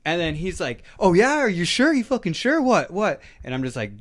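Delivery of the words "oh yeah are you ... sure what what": high, loud confrontational challenge; imitating a guy picking a fight; aggressive taunt. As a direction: fire off taunting questions, raised voice. Acts out aggressor challenging him.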